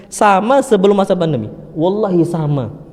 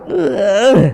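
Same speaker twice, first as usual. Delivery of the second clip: short pained groan of a sick man; imitated groan; 4 semitones higher than usual. Sick Imam groaning in pain, imitated moan. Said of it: "beliau ngerang".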